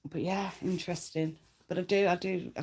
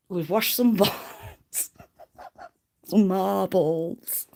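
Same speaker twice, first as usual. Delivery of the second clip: laughing; 3 semitones higher, 5 dB louder, much slower than usual. Real, not acted. Giggling at an innuendo.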